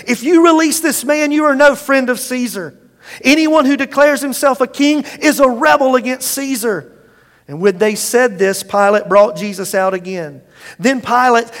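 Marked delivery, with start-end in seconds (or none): 0.1-6.9 higher, louder crowd shout; shouted threat. Acted. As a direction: push Pilate with the threat.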